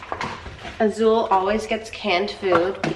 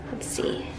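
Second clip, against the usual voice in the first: ordinary talk, nothing marked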